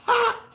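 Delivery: sharp high gloating ha into laughter; gleeful gloating laugh. Real, not acted. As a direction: laugh right at the rivals. Gloating over rivals.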